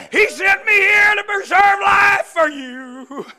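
loud, ringing preacher's cry; full-force preaching. Acted. Preacher retelling Joseph's reunion to the congregation, full-force preaching. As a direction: preach it like a revival.

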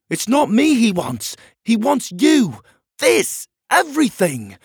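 high, loud, emphatic shouting; shouted back. Acted. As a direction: hammer out each phrase at a shout. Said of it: "Pockets shouted back".

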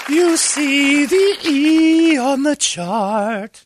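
very high, very slow sung princess voice; cartoon princess. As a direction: sing the question like a Disney princess. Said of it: "sounds like a Disney princess".

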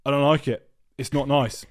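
loud flat mock complaint, no softening; blunt loud complaint. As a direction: say it bluntly, no apology, straight at the waitress. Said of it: "you just go".

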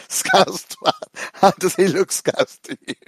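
incredulous retort. Retold or imitated. Mate retorting to a drunk fan's insult, incredulous and mocking. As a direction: throw it back at him in disbelief. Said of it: "He was like".

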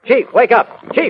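loud call to wake a sleeper; calling to wake him. Acted. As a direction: rouse the sleeping chief, voice up high. Waking the snoring chief.

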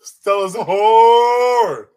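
drawn-out, high, loud holler; loud blurt. Real, not acted. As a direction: holler it slowly, stretching the last word. Blurts loud verdict when asked.

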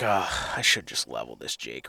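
murmured low and soft, hunter close to the birds; under your breath so the turkey won't hear; hushed thought. Retold or imitated.

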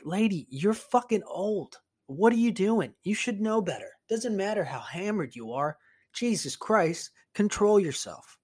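lightly acted high-pitched scolding; exasperated scolding. Painter silently scolds drunk older lady, retold in high exasperation. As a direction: scold her in disbelief, pitch lifted.

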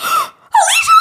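very high-pitched excited shriek; imitated scream. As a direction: scream the name like a starstruck fan. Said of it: "like, screamed".

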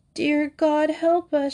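high pleading cry; crying out to God. A narrator's reading. Gretel cries to God in despair, high and pleading. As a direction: beg heaven for help. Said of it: "she cried".

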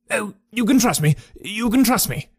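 high, desperate pleading. A narrator's reading. Frightened trainer begs Holmes to trust him, high and pleading. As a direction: beg him to believe you.

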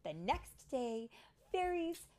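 building, wonder-filled storybook reading; high, sweeping storytime narration; story narration for children. A narrator's reading. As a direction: rise with excitement, drawing out phrases.

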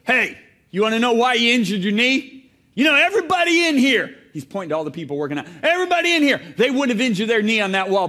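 gruff therapist scolding the patient; gruff trainer. Retold or imitated. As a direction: play the rude gym owner scolding. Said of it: "he's so gruff".

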